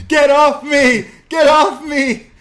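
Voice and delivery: very high, loud drawn-out shriek; imitated panicked scream. Host mimicking commentator grabbed by the leg, screaming. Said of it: "Vic Joseph just starts screaming".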